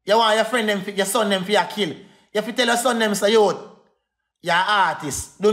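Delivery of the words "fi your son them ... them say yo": usual speaking voice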